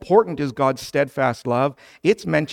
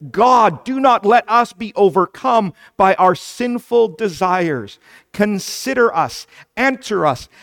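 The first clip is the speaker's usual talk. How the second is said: loud, raised pulpit plea to God; impassioned prayer; 4 semitones higher, 7 dB louder than usual. Acted. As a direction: cry out in fervent prayer, punching each petition. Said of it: "you and I should pray with David".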